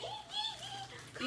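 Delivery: high whimpering dog whine; retelling the uncle's story, mimicking the dog's high whimper; imitating a crying dog. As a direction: whine like a scared puppy. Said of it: "the dog just started crying".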